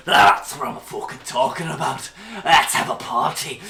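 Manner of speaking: roaring, rough battle cry; rowdy Skiono. Acted. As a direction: bellow happily before a brawl. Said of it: "cracks, and goes".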